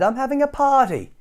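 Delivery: high sing-song invitation; little Miss Fun calling. Acted. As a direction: call the guests in like an excited little girl. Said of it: "she cried".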